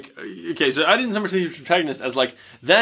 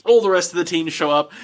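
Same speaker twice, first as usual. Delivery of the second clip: ordinary talk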